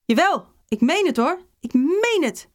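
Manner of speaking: high-pitched, exasperated teacher protesting; insistent calling. A narrator's reading. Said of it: "riep de fluit". (hoog, nadrukkelijk geroepen)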